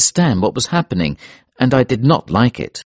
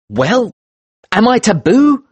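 high, rising impatient question; impatient, pressing for a reply; 12 semitones higher, 4 dB louder than usual. Acted. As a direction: demand an answer, pitch climbing sharply. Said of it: "I asked impatiently".

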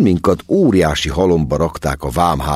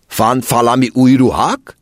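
"Fan falami új ruhák?" asked again, higher and insistent; a foreign officer asking again; foreign-accented repeat; 7 semitones higher, 4 dB louder than usual. A narrator's reading. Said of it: "kérdezte".

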